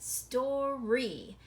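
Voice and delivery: "Story" split, ending in a drawn-out ree; long E. Shown as a demonstration. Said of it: "It's making the long E".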